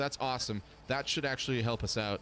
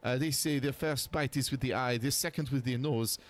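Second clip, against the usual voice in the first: put-on French accent; 3 semitones higher than usual. Sophie voice continues.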